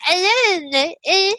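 high-pitched mock Valley Girl; exaggerated Valley Girl accent, pitched high. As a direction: imitate a Valley Girl's whiny chatter. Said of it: "she's talking in straight Valley Girl".

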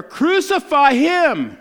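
full-throated crowd yell. A narrator's reading. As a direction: belt it out like a mob. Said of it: "they shouted all the louder".

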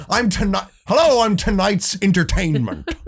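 high, theatrical villain announcement; Heath Ledger's Joker. Acted. Re-enacting the line for security guys, Joker voice. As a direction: pitch it up and play the showy villain. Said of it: "I did the Heath Ledger voice".

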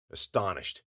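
ordinary delivery

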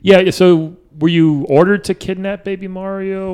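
ordinary delivery

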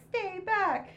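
high, bold stay back; mock heroic warning. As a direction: call it out high like a hero. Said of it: "And he's like".

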